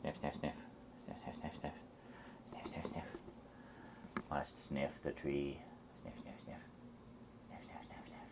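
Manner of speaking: soft whispered sniff, sniff; sniffing the air. Pretend. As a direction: breathe sniff, sniff softly like a cat. Voicing the cat sniffing.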